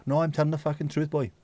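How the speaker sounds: ordinary delivery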